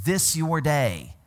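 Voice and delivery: This your day, slow and deliberate; slow quote. Retold or imitated. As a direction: lean on each word. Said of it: "when he uses the phrase".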